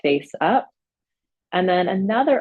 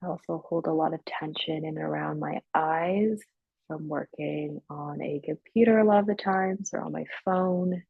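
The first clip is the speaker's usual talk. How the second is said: guided meditation. Real, not acted. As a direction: ease them gradually into stillness, leaving long silences. Leads guided breathing and relaxation.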